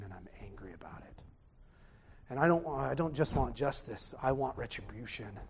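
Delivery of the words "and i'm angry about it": hushed admission of anger; whispered confession. Real, not acted. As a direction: whisper it, fast and much softer.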